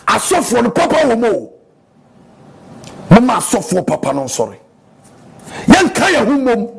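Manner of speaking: fervent sermon to the church; preaching. Acted. Pastor preaching to his church, fervent. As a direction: preach it out to the congregation.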